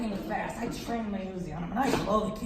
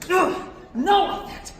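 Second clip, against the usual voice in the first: effortful grunt; 9 semitones higher, 10 dB louder, slower than usual. Retold or imitated. Comic acting a useless punch.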